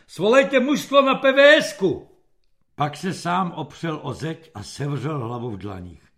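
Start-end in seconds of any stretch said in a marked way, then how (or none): 0.1-2.1 high, loud military command; shouted order. A narrator's reading. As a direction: bellow it at a dim-witted corporal. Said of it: "zachrčel na dokonale zblblého". (hlasitě vykřiknutý rozkaz)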